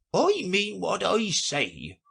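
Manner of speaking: slow, high, huffy retort; bristling at being corrected; offended. A narrator's reading. Mock Turtle snaps back at Alice, slowly offended. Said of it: "in an offended tone".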